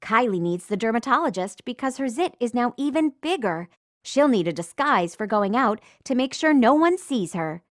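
ordinary talk, nothing marked

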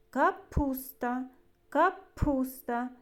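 "Капуста" slowly, with the middle P popped; slow "Капуста" with an exaggerated P; emphasized P sound. Shown as a demonstration. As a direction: split "Капуста" just enough to make П ring out. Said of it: "щоб добре було чути звук П".

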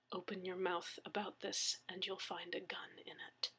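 very soft, whispered threat; menacing whisper. A narrator's reading. Attackers' warning to the victim, menacing whisper. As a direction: threaten under your breath.

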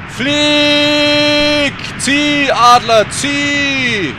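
long loud high cheering; urging the flying eagle on, loud; urging call. Real, not acted. Urging bird to fly.

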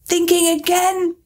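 high, slow teasing duchess. A narrator's reading. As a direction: needle her like a nosy duchess. Duchess voiced.

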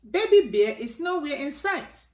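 ordinary delivery

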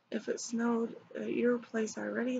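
normal talking voice

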